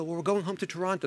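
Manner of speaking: soft, timid student imitation; nervous student. Student explaining an absence to professor, mock nervous. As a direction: quietly mimic a student shy of the professor. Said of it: "they're so nervous 'cause they're speaking".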